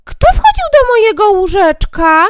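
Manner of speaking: very high, loud dwarf cry; dwarf calling out. Acted. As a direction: call out in alarm like a tiny dwarf. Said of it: "zawołał". (wysoki okrzyk krasnoludka)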